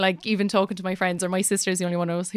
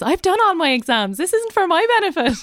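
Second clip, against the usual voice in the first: higher, climbing teacherly scolding; mock teacher lecture; 8 semitones higher than usual. Teacher quotes her favourite classroom line, mock lecture. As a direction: scold the class, pitch rising as you go. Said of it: "I hate it when my teacher said that to me".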